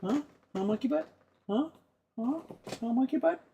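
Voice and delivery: high, rising coaxing voice for the dog. Real, not acted. Owner coaxing the dog, high and sing-song. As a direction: coo up high at the little dog.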